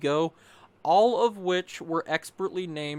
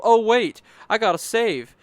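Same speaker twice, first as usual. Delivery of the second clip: loud, higher exclamation of remembering; sudden realization; 7 semitones higher, 10 dB louder than usual. Retold or imitated. Player retelling his sudden need to save, acted-out realization. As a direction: blurt it out louder and higher.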